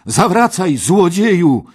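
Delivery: high, very loud call after a thief; challenging shout. Acted. Parsival calls to the fleeing knight, shouting high. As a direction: yell to halt someone riding away. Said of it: "krzyknął Parsival".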